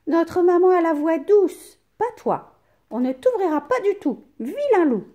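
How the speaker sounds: put-on high, loud kids scolding; little goats telling off the wolf; goat kids defiant. A narrator's reading. Kids scolding the wolf, high loud voices. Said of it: "répondent les chevreaux".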